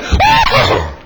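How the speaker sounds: loud demonstration church shout; uninhibited holler. Shown as a demonstration. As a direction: show them how to cut loose. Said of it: "If you wasn't so dignified right now, you'd just go ahead and say".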